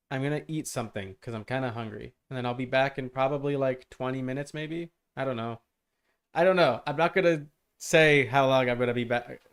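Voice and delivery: ordinary talk, nothing marked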